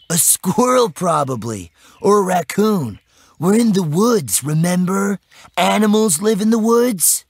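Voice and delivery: put-on high, loud, dismissive kid voice; boy friend's voice, teasing.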